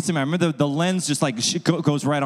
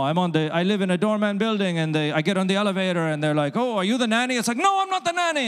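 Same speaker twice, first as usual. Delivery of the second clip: a hint of an offended woman, rising high at the end; lightly voiced Indian woman; 5 semitones higher than usual. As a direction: play her mild indignation.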